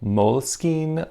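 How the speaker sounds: Moleskine as "mole-skeen", two slow syllables; long ee at the end of Moleskine, drawn out; alternative pronunciation. Shown as a demonstration. Listing viewers' suggestions, Moleskine said "mole-skeen". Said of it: "other recommendations for how to pronounce this".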